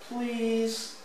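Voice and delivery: high, drawn-out please; small high pleading voice; pleading child. A narrator's reading. Henry begs for one more slide.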